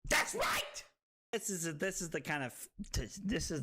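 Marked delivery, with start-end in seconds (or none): 0.1-0.8 very high defiant yell; hammed-up screamed declaration; Leonardo DiCaprio. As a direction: scream it like a movie outburst. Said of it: "just like Leonardo DiCaprio".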